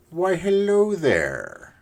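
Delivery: high, drawn-out mock-suave hello; silly greeting. Player greeting a cow he found, silly drawn-out greeting.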